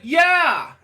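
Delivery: a loud, happy "Yeah!" from someone else; excited yell. Retold or imitated. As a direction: shout it like he's cheering. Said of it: "and he's like".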